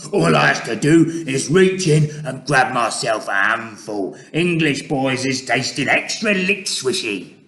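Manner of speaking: loud gloating giant voice; hungry monster's brag; greedy giant. Acted. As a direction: shout out a nasty boast. Gizzardgulper gloating.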